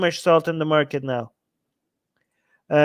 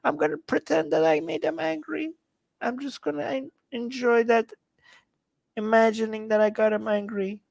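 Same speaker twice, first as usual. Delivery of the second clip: high, soft mock-pouting voice; whiny upset troll; 5 semitones higher, 6 dB quieter than usual. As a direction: console yourself in a tiny, pathetic troll voice. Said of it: "he's like".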